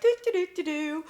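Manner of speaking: high, aimless "doo-doo" humming noise; high-pitched doo-doos of someone wandering cluelessly; sing-song, oblivious little doo-doos; clueless wandering ditty. Acted. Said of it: "kinda wandering around like".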